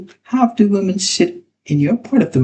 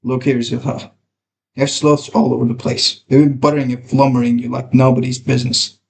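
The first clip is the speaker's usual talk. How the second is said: lightly voiced older woman, scolding; a hint of a scolding Alice; Alice's voice; 6 semitones lower than usual. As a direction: warn Silva like a sharp aunt. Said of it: "Alice's voice".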